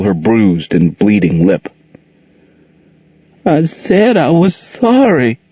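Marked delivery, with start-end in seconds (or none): 3.4-5.4 higher, slow, tearful mumble; mumbling through tears. A narrator's reading. Monique after the slap, mumbling through tears. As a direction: whimper it out, hurt. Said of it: "she mumbled through her tears".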